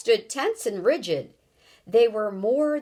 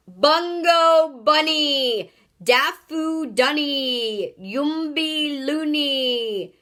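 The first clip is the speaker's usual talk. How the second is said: slow, high, loud nonsense chant; drawn-out screamed gibberish; 6 semitones higher, 5 dB louder, much slower than usual. A narrator's reading. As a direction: bellow each word slowly on a high pitch. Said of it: "he screamed".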